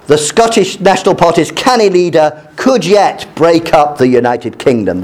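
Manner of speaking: measured read-aloud of a news article. Acted.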